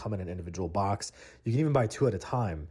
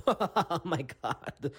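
drawn-out, hammed-up laughing fit; mock snarky laughing; 6 semitones higher, much slower than usual. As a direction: imitate a clerk blowing up laughing. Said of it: "just blows up laughing".

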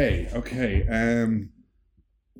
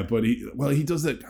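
ordinary talk

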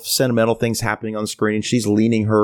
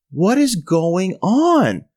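drawn-out, higher-pitched disbelief; bewildered exasperation; 6 semitones higher, much slower than usual. Retold or imitated. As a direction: stretch the baffled question slowly. Bewildered inner voice in cinema.

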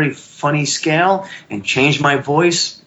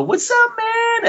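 drawn-out, very high excited greeting; re-enacted starstruck high voice; much slower than usual. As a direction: squeal the greeting high and slow, retelling it. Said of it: "your voice goes that high".

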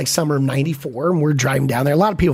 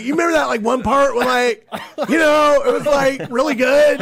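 clueless movie fan; 8 semitones higher than usual. Retold or imitated. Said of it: "I'd be like".

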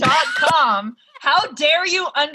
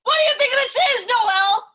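mock outraged yell; 12 semitones higher than usual.

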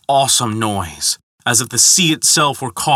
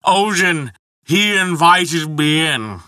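put-on high, slow, slurred drunk; drunken burble; 6 semitones higher, slower than usual. A narrator's reading. Drunken giant blaming Odin's invitation, burbling. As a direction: stretch and slur it tipsily. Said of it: "he burbled".

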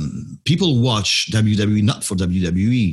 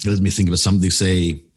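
ordinary delivery

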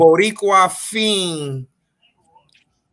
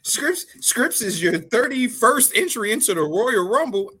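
usual speaking voice

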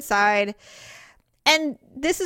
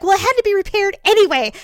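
quick, raised, defensive comeback; higher, louder and faster; defensive retort; 8 semitones higher, 6 dB louder, faster than usual. Retold or imitated. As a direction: snap back at the criticism. Stepdad defending himself to Mom.

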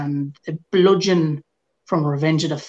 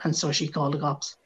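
ordinary talk

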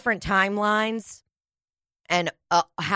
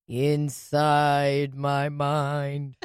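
very slow; 5 semitones lower, much slower than usual. Pretend. Joking slow spooky voice.

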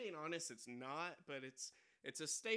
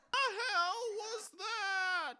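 loud, high robber yell; frantic shouting hostage taker; 10 dB louder, slower than usual. Acted. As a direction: bark it like a stick-up man. Robber hates joke.

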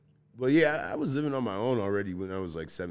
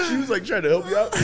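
ordinary talk

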